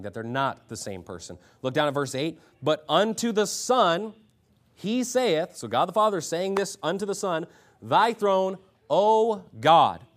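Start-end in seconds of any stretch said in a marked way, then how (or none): 2.6-5.6 slow, high, loud verse opening; preacher declaiming from the pulpit; proclaimed scripture. A narrator's reading. 7.8-10.0 loud, high declamation; grand, forceful address; proclaimed scripture. A narrator's reading. Proclaims God's words from the verse.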